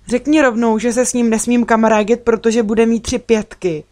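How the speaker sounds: high, loud, aggrieved blurting; hurt outburst. A narrator's reading. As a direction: blurt it out, offended. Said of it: "vyhrkla jsem a tvářila se ublíženě".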